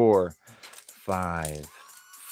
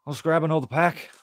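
ordinary delivery

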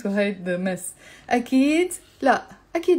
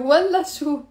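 والله شو raised and falling; scornful refusal; 4 semitones higher, 4 dB louder, faster than usual. Shown as a demonstration. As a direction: scoff والله شو as a no. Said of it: "You see the tone in my, of my voice?".

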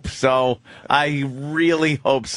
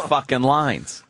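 ordinary talk, nothing marked

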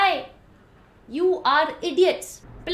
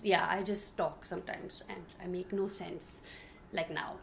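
much lower and softer; quiet admission; 10 semitones lower, 14 dB quieter than usual. Real, not acted. Vlogger admitting she makes no sense, low and soft. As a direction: let it sink to a low murmur. Trailing admission before loud repeat.

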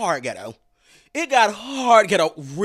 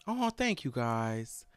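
normal talking voice

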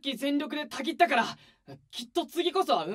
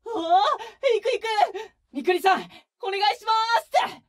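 excited; 9 semitones higher, 9 dB louder than usual. Acted. Eager to join practice.

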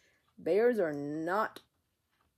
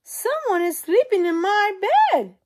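high, loud urgent cub voice; Baby Bear calling; 11 dB louder than usual. Acted. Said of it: "Baby Bear goes to his bed and says".

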